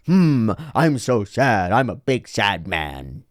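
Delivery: slow, drawn-out hammed-up sadness; exaggerated sad cartoon character. Anime character spelling out an obvious emotion, hammed-up sad. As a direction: mope like a cartoon, words sliding downward. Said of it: "Or the animator goes".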